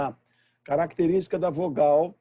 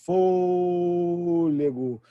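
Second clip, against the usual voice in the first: fôlego slowly stretched, ô held for ages; long drawn-out word; 3 semitones higher, much slower than usual. Shown as a demonstration. Teacher showing how long a stressed vowel can last, very slow. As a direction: drag fôlego out on one breath. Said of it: "Enquanto você tiver".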